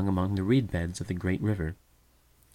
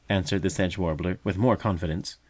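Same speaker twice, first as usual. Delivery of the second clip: usual speaking voice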